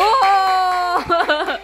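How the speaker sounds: loud, high delighted cry; delighted shout. Real, not acted. Delighted exclamation at bubble.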